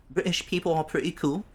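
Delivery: high-pitched mock British accent; bad British accent. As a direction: slip into a hammed-up British accent. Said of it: "I have done the worst British accents ever lately".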